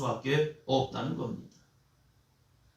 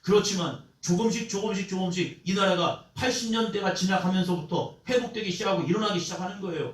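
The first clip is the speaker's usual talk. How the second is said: ordinary talk, nothing marked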